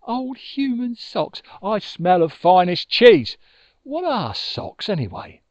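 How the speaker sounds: a hammed-up huff of disgust at the insult. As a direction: Moon insulted by Badger's sniff, mock disgust.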